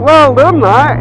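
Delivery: put-on loud high cheer for the cheeky kid; mock cheer. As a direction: praise him like a proud fan. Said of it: "I was like".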